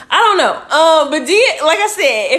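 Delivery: high, loud flustered laugh; awkward laugh. Real, not acted. Streamer reacting to a strange comment, flustered laughing. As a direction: caught off guard, laugh through it. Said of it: "awkward reaction to comment".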